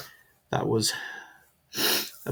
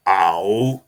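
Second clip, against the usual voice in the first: stern boss; 3 semitones lower, 8 dB louder than usual. Retold or imitated. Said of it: "he looked at me and he said".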